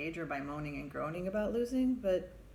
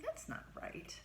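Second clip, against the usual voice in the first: indignant thought; 7 semitones higher, 8 dB quieter than usual. Retold or imitated. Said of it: "I'm, like, indignant".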